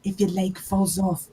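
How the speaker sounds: sore throat voice. Acted. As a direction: hiss it through a sore throat. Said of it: "That's just my sore throat voice".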